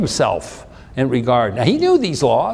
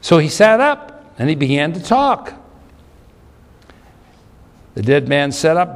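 ordinary talk

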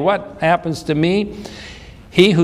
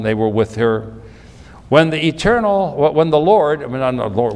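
usual speaking voice

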